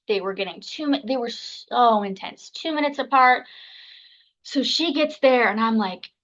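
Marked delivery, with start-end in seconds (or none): none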